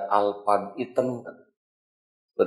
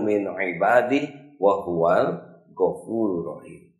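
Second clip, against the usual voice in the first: Quran recitation; 2 semitones higher than usual. Acted. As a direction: recite the verse with care.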